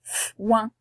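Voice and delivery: long hissed "f", then "ouin" apart; phonics-style "f", short gap, "ouin"; split into sounds. Shown as a demonstration. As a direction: separate "f" from "ouin" for a young reader. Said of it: "On entend f et ouin".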